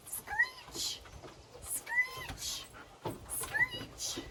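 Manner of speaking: very high, thin screech; bat screech. Shown as a demonstration. Teacher screeching like a bat for the kids. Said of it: "Can we fly like a bat".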